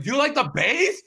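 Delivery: retold club shout. Man retelling club chat-up to his ex, mock shouting. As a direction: imitate calling over a loud club. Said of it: "But it was so loud".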